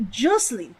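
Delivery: Jocelyn opening with "Joe" as in Joseph. Shown as a demonstration. Streamer retrying the name with Joe as in Joseph. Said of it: "like the Joe is Joseph".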